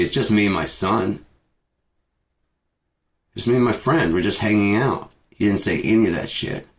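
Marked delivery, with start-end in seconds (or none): 0.0-5.1 imagined innocent customer. Retold or imitated.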